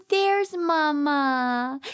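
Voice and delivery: ordinary talk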